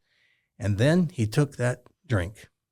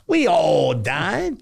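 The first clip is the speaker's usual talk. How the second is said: put-on slow, high, easy old man; imitating Reggie; 4 semitones higher, 3 dB louder, much slower than usual. Reggie tells the host everyone dies, slow and cheerful. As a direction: lean back and drawl it cheerfully.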